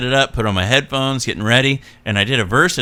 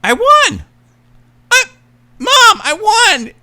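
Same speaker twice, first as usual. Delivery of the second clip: put-on very high, shrieking kid's voice; high, loud child yelling to his mom; excited little kid; 6 dB louder than usual. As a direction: squeal like a five-year-old who just won. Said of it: "I hear through my headphones".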